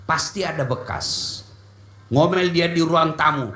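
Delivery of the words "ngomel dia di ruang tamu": usual speaking voice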